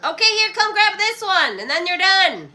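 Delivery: loud, high call across the room; calling daughter over. Real, not acted. Mother calling her daughter to take the last piece, loud call.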